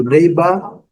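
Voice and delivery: British "neighbour", final R dropped; British neighbor. Shown as a demonstration. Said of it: "No, no inglês britânico". (neighbor com sotaque britânico)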